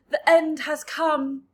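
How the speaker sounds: slow, loud despairing cry; doom-laden cry. A narrator's reading. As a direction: announce the end in dread. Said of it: "Miss Spider cried".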